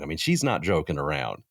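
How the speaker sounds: usual speaking voice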